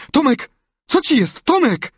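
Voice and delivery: loud, high alarmed cries; alarmed calling. A narrator's reading. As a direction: call out in alarm to a brother. Sid alarmed, calls moaning brother.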